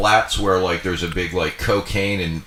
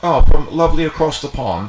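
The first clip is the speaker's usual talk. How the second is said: mock British accent; 8 semitones higher, 7 dB louder than usual. Joking about being English.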